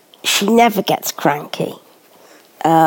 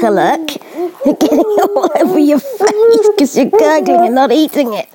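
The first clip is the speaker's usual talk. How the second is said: much higher, loud mock telling-off; playful scolding; 10 semitones higher, 4 dB louder than usual. Real, not acted. Mother telling baby off for gurgling food, playful high scolding.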